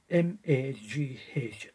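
M A G H, each letter named slowly and separately; spelled out letter by letter. Shown as a demonstration. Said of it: "they called it".

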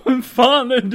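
high, loud mock alarm; hammed-up frightened outburst; panicked challenge. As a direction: yelp it at a voice in the dark. Startled captive challenges voice in dark.

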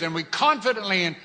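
loud, high, fervent scripture reading; preacher declaring verses loudly; preaching at full force. Acted. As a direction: preach it to the congregation.

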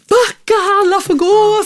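acted-out overwhelmed parent losing it; frazzled retold outburst. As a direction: snap at everyone to back off. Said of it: "jag blir så här".

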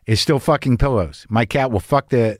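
ordinary talk

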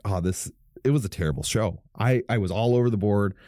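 usual speaking voice

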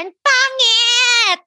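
drawn-out, high "pangit", hammed up; mock exclamation of disgust. Friend imitating how Ina tells you stories, exaggerated exclamation. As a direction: imitate a friend's big, stretched verdict. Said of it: "ganito siya".

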